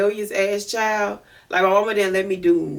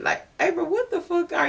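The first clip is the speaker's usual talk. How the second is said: incredulous self-scolding; 10 semitones higher than usual. Retold or imitated. Scolding herself mid-story.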